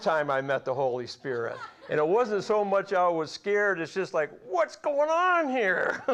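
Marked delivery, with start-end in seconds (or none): 4.5-6.0 high, slow puzzled question with a laugh; bewildered. Retold or imitated. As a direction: ask it like you just can't make sense of it. Retold surprise at meeting Spirit.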